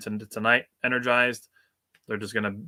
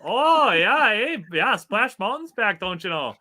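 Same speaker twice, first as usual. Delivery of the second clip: very high, louder, slow hammed-up Canadian drawl; mock Canadian accent; 9 semitones higher, 5 dB louder, slower than usual. Answering co-host's joke, slow mock Canadian accent. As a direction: ham up the Canadian twang. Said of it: "start talking like that all day".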